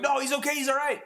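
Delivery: very high-pitched mock objection; imitated protest. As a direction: mimic friends insisting in a high voice. Friends' protest at a knockout, re-enacted.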